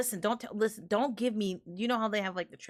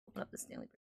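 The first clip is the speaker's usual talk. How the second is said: whispering; 15 dB quieter, much faster than usual. Real, not acted. Unvoiced aside.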